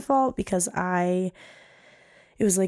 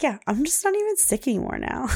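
lightly voiced little girl, higher; a hint of a small child gushing; 5 semitones higher than usual. Mom imitating her daughter praising the doctor, lightly higher child voice. Daughter Sawyer quoted in story.

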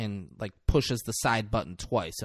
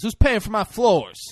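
indignant yell; 10 semitones higher, 8 dB louder than usual. Retold or imitated. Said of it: "The guy says".

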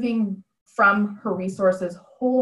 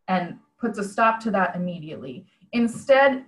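ordinary talk

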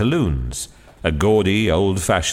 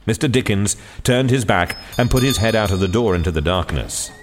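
plain reading voice

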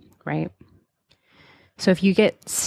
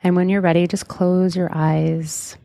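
guided meditation. Acted. Leads a hypnotic guided meditation.

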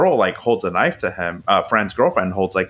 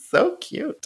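laughing tease. Real, not acted. Teasing back after crude joke.

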